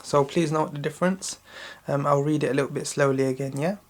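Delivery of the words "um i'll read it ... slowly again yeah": usual speaking voice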